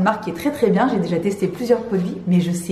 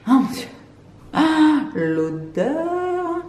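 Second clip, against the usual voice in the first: high, very slow, drawn-out sigh of bliss; an ecstatic, stretched-out "ah"; swooning delight; 8 semitones higher, much slower than usual. Real, not acted. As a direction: melt over the smell. Swooning over the cream's scent.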